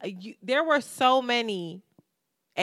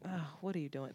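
normal talking voice

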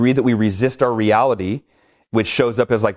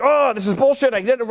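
mock frustration; 12 semitones higher than usual. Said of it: "and I'm like".

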